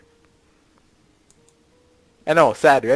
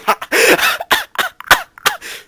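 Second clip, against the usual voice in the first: very high, loud, broken-up laughter; dying high laugh; 8 dB louder than usual. Pretend. Boy dying of embarrassment before his date, high laughing. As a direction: laugh in high bursts as if expiring. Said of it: "That's him dying".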